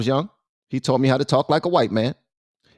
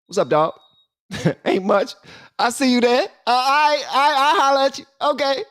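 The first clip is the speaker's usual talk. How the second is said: hyped homie greeting; 11 semitones higher than usual. Retold or imitated. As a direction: greet your boy with lots of energy. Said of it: "I'm like".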